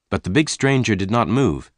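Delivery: plain reading voice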